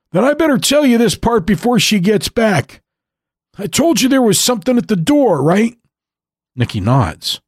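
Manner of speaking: put-on old grandfather storytelling. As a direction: spin the tale like an old man remembering a fright. Pop Pop resumes the tale.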